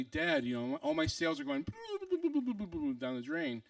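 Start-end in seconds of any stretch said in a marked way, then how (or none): 1.7-2.9 high sputtering slide downward; long burbling sound of something swirling away; mimed plummeting noise. Retold or imitated. Imitates sales going down drain.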